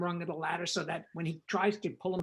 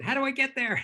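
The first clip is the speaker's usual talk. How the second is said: higher and louder eager question; eager burst; 7 semitones higher, 5 dB louder than usual. Retold or imitated. Director eager to rush over to Warner Brothers, excited. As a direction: blurt it out, unable to wait.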